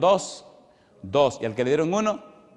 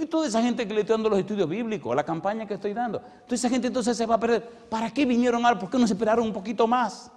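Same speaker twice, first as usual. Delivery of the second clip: higher, half-laughing plea to the angel; pleading protest; 9 semitones higher, faster than usual. Retold or imitated. Preacher in his dream pleads with the angel, higher half-laughing plea. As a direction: beg the angel, amused at yourself.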